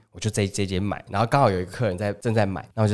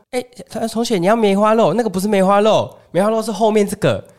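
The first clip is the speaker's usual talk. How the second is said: higher auntie voice, setting the customer straight; correcting market auntie; 9 semitones higher than usual. Retold or imitated. Auntie telling the customer she has the wrong cut, in a higher voice. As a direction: correct the young buyer like a stall auntie. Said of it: "然后就说".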